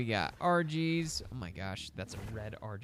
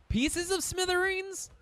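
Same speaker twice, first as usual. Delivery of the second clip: incredulous high. Real, not acted. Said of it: "That's not proper grammar".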